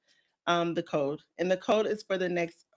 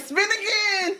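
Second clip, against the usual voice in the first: impatient chat voice; 10 semitones higher, 4 dB louder, slower than usual. Retold or imitated. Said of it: "everybody like".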